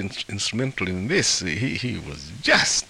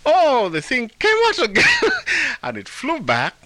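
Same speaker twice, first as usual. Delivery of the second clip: high, loud and breaking into laughter; laughing; 6 dB louder than usual. Real, not acted. Storyteller reaches the thing coming out again, laughing. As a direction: exclaim it through helpless amusement.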